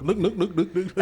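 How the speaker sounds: high, loud mimicry of a Vietnamese accent; mock Vietnamese accent. Mocking his least favorite accent, exaggerated Vietnamese accent. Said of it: "It's 'cause they're like".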